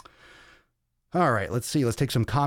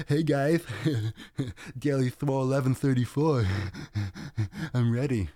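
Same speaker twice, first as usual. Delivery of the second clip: higher, laughing between gasps, out of breath; breathless laughing greeting; 4 semitones higher than usual. Retold or imitated. Starting the podcast out of breath, laughing breathlessly. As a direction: replay bursting in winded and giggly. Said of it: "And I'm, like, all out of breath".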